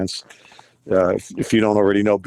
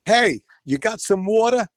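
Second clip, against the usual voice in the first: put-on Brooklyn accent; 11 semitones higher than usual. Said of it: "Where you from, Brooklyn?".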